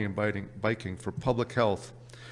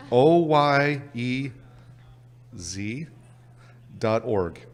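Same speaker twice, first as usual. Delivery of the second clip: "O Y E Z" spelled letter by letter, slow and louder; spelled out slowly; 6 dB louder, much slower than usual. Shown as a demonstration. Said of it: "My Canadian accent once again".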